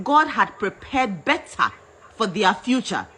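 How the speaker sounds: ordinary delivery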